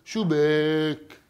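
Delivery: Syrian dialect. Shown as a demonstration. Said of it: "بيقولوا".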